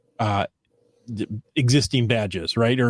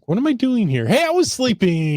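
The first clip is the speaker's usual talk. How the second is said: high, a bit louder, indignant pup; a hint of a woken-up dog grumbling; sleepy dog voice; 11 semitones higher, 3 dB louder than usual. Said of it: "He's like".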